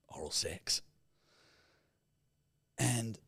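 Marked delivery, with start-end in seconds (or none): 0.1-0.8 hushed whisper; sheepish whispered word; whispered aside. Acted. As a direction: drop it to a whisper.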